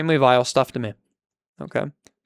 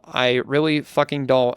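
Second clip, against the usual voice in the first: ordinary talk, nothing marked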